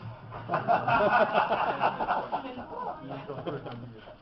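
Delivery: rire franc et sonore; éclater de rire fort; grand éclat de rire. Real, not acted.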